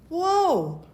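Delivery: high, drawn-out mock shock; stunned whoa. Reacting to being sent to hospital now, high stunned whoa. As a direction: let the surprise lift your voice. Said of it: "And I'm like".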